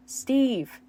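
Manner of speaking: loud desperate call of a name. Acted. Dustin screaming for Steve in the Upside Down, loud call. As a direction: yell the name across the dark.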